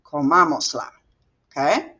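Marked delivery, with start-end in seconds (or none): 0.0-0.9 comamosla with the accent on MA; stress on MA. Shown as a demonstration. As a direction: repeat comamosla as one word, stressing co-MA-mos-la. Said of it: "I put an accent there".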